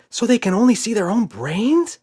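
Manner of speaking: high, eager question; a little girl thrilled by the idea; excited child. A narrator's reading. Emma asks Daddy, high and delighted.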